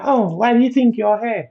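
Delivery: high-pitched mock Jamaican accent; imitated Jamaican accent. Jamaican man asking why he's in hospital, mock Jamaican accent. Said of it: "Jamaican accent and all that".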